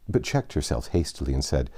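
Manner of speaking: ordinary delivery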